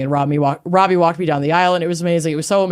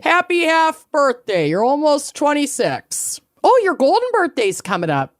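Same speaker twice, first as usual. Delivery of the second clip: excited; 7 semitones higher than usual. Real, not acted. Excited birthday wishes.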